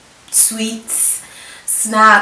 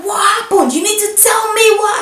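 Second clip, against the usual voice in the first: angry demand; 13 semitones higher, 3 dB louder than usual. Retold or imitated. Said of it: "She was like".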